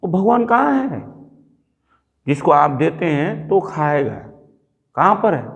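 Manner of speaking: put-on high, eager little boy asking; child's curious question. Boy asks father where God is, high and curious. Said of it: "तो मैं उसको बोला".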